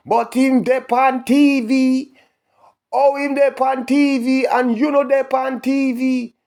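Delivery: high, loud, slow indignant rant in Jamaican patois; heated patois rant. Real, not acted.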